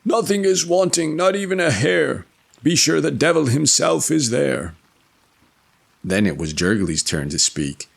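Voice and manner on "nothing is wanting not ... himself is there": high, raised cry; high crying character voice. Acted. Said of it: "Michael cried".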